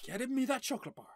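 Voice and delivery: ordinary talk, nothing marked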